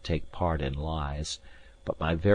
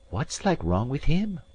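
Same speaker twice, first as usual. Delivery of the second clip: maid's voice; 8 semitones higher than usual. A narrator's reading. Maid asks about sick lad.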